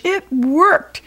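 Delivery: high, delighted shout; excited cry. A narrator's reading. George celebrates the machine working. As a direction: celebrate the win. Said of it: "cried George".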